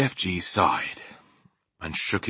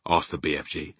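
plain reading voice

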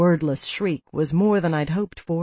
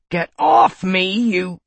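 angry; 4 dB louder, slower than usual. A narrator's reading. Kyle fighting to throw her.

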